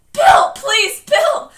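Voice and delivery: high, loud pleading shriek. Acted. Beverly calling to Bill in danger, shrieking. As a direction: shriek his name, begging. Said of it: "he heard Beverly shriek".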